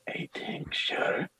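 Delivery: slow, soft whispered question; whispering priest. Acted. Priest questions a peddler's offered tincture, soft slow whisper. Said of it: "I got a perfect tincture for that".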